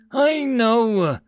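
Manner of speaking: high, loud strained yell; yelled with effort. A narrator's reading. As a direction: yell back while straining. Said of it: "yelled the gunner as he grunted with effort".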